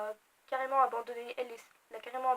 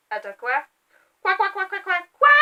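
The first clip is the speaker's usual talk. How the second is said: rising, louder string of stunned quoi; shocked disbelief; 6 semitones higher, 9 dB louder than usual. Retold or imitated. Reader reenacting the twist, stunned rising quoi. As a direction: react to a plot twist in disbelief. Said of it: "je vais vous refaire".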